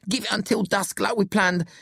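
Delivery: high-pitched pleading voice. A narrator's reading. Billy urges his friends to use the daylight, pleading. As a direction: press the plea, high and insistent. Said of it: "Billy pleads".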